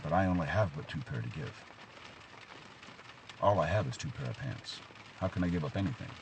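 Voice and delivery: soft, low, deflated reply. Acted. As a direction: drop your voice, reluctant to give. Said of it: "kind of lowered his voice".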